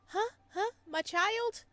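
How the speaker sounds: ordinary talk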